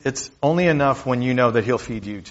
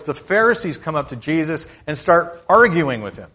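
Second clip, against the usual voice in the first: normal talking voice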